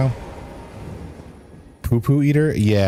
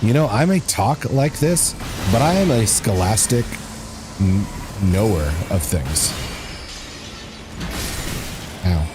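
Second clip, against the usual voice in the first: bro talk. Acted. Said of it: "I will endeavor to talk like a bro".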